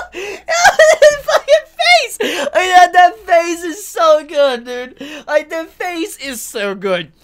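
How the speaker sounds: high, loud words broken by laughter, slow; laughing while talking. Real, not acted. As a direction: gush through the giggles. Laughing over the manga panel.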